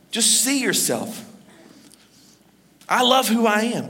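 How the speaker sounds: normal talking voice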